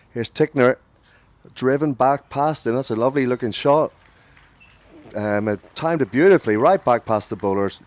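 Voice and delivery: excited radio commentary on a fine shot; live play-by-play. Real, not acted. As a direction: call the boundary with a lift, settling as it ends.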